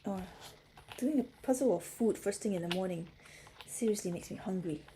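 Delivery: ordinary talk, nothing marked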